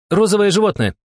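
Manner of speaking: high-pitched urgent yell; shouted clue. A narrator's reading. Steklova shouting a clue to her partner, urgent. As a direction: call it out loud and high. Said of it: "крикнула Стеклова".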